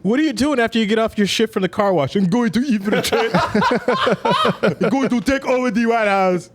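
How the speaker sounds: put-on high, laughing African accent; joking African guy voice, fighting laughter. Mocking an African car wash worker.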